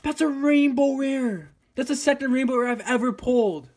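high, loud shouting; excited. Real, not acted. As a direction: shout out the rainbow rare. Pulls a rainbow rare.

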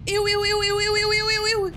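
high, loud repeated ews; disgusted squeals. Real, not acted. Streamer grossed out by a monster, loud high ews.

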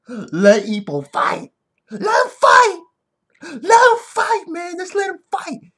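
high, loud, desperate shout to the coach; hammed-up screaming plea; impassioned plea. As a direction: beg at full pitch for the fighter's chance. Acted plea to Ippo's coach.

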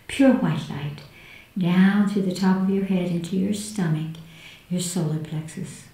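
hushed and soothing, guiding inward. Acted. Leads a guided meditation.

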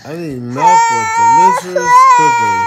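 very high, loud monster voice; Cookie Monster voice. A narrator's reading. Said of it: "said Cookie".

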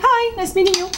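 high cheery hi to a viewer; bright high greeting. Real, not acted. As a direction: wave hello in a high voice. Greeting a subscriber.

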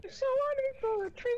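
falsetto crying voice. Pretend. Continues high falsetto bit.

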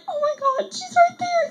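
very high, squeaky mock crying; retold tearful excitement. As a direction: squeal it as if overcome on seeing an idol. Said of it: "And I started crying".

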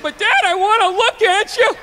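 high, mock kid whine; pleading child. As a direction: play a kid begging dad to let him look. Father voices his pleading child.

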